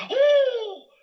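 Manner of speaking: high loud startled gasp; high loud yelp of fright; frightened cry. Acted. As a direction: jump out of your skin.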